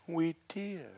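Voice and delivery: soft, high little squeak; mock-intimidated tiny voice; cowed self-imitation. His cowed reply to her.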